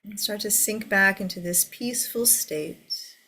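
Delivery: guided meditation. Acted. Leads a meditation.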